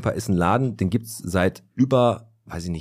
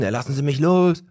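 retold drunk yelling; 6 semitones higher, 3 dB louder than usual. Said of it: "Ah, nee, anders".

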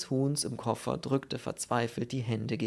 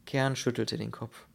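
ordinary delivery

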